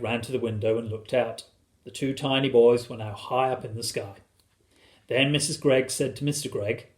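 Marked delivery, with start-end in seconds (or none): none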